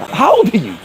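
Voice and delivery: high, suspicious question; retold incredulous age check; imitated disbelief. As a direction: ask it in mock astonishment. Producer questions his age.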